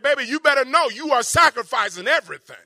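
loud preacher's warning to the church. Acted. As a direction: drive the warning home, loud and urgent. Preacher voicing a warning quote.

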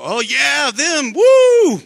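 high, loud hammed-up woo; mock cheer. Pastor mocking cheering faith in others, loud and high. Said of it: "look at faith and go".

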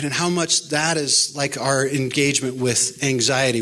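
ordinary talk, nothing marked

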